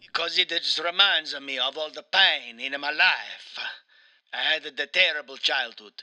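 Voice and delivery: mock-mournful Italian game character; Mario with Italian accent. Mario recounts his sad childhood, in a mock-mournful Italian accent. Plays Mario being interviewed.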